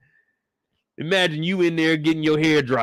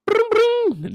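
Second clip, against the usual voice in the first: high, loud imitated siren; mock siren noise; 4 dB louder than usual. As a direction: make a quick siren sound.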